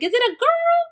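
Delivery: high, eager question; excited question. Retold or imitated. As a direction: ask it breathless with hope. Mother asks about baby.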